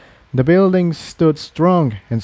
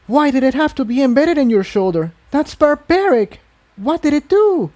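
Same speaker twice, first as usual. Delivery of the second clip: shocked outrage; appalled; 14 semitones higher than usual. Acted. Unicorn appalled by the refugee's implant. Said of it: "looked appalled".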